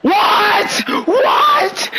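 high, loud disbelieving shout; squeaky outraged what; shocked yell. Real, not acted. Shocked reaction to friend.

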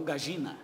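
"gagina" with the ll said as zh, ga-zhi-na; porteño "gagina"; "gagina" with a soft zh; Buenos Aires pronunciation. Shown as a demonstration. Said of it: "Eles falam gagina".

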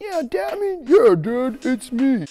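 loud, high, protesting impostor; badly faked brother's voice. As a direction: insist in a silly put-on voice. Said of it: "but it sounds like Jacob".

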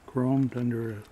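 normal talking voice

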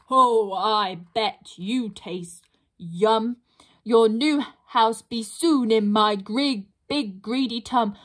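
slow, relishing troll voice; hungry troll. Acted. Troll gloats over the pixie he means to eat, slow and greedy. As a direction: savour every word like a meal.

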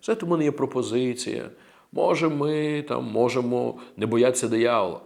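soft, low, noncommittal proposal; quiet, hesitant mock suggestion; tentative suggestion. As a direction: float it meekly, with no authority behind it. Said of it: "він не говорив так".

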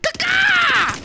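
high shrieking caw-caw; bird call. Pretend. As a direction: screech like a crow. Imitates a bird.

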